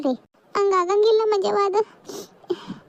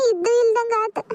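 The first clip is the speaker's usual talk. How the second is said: tearful; 4 semitones higher than usual. Acted. Grieving mother's inner monologue.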